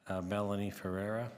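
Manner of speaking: ordinary talk, nothing marked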